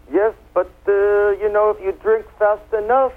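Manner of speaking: high-pitched mock French accent; imitation French accent, pitched well up; terrible French accent. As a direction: put on a high, hammed-up Frenchman. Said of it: "with my terrible French accent".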